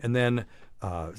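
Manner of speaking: ordinary talk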